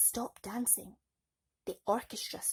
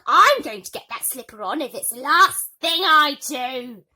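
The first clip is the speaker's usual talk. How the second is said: loud determined vow; 5 semitones higher, 18 dB louder than usual. Acted. Gertrude determined.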